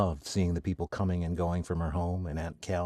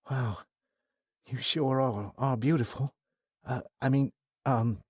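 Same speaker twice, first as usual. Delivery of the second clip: put-on higher, stammering smitten young man; nervous young man; 6 semitones higher than usual. Kai introducing himself to Athena, nervous and smitten. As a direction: trip over the words, shy.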